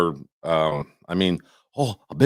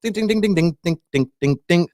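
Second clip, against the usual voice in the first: gong imitation; 8 semitones higher than usual.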